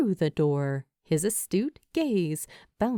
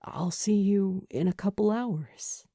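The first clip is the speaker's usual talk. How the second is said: whispered. A narrator's reading. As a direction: hush it tenderly. Julie softly saying goodbye.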